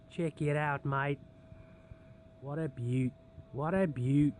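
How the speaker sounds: put-on Aussie twang, admiring; Australian accent. Vlogger admiring the finished bake, playful Australian accent. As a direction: play up a mock-Australian accent. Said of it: "speaking in an Australian accent".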